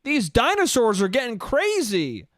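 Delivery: high, drawn-out mock movie-star voice; Chris Pratt as Mario. Actor playing Mario calls for his raptor, high-pitched mocking impression. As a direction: ham it up in a high, stretched-out parody. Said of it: "better than Chris Pratt being like".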